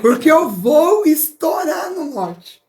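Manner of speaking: high, loud and slow, laughing through the words; laughing excitement. Real, not acted. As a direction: burst out gleefully as you promise to party. Joking about living wildly. (empolgada, mais aguda e alta, rindo)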